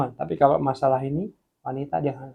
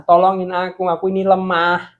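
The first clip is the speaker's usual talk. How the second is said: acted plea; 6 semitones higher, 10 dB louder than usual. Acted plea to God for help.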